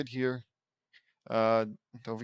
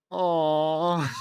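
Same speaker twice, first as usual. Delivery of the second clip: long, drawn-out cooing aw; group "aw"; 2 semitones higher, 6 dB louder than usual. A narrator's reading. Everyone reacts to the couple kissing, cooing aw. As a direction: coo a drawn-out "aw".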